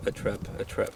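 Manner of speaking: "a trap, a trap" breathed out in a soft whisper; whispered longing. Acted. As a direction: whisper the wish, barely aloud.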